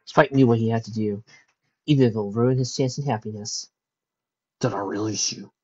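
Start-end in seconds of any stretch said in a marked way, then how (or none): 4.6-5.5 low, quiet, gentle question; soft dragon voice. Acted. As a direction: let her go tenderly. Spike gently lets her go.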